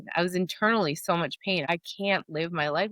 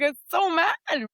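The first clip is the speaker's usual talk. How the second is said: higher, louder, slow hammed-up fury; mock angry outburst; 11 semitones higher, 4 dB louder, slower than usual. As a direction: ham up a frustrated outburst. Acting out angry journaling.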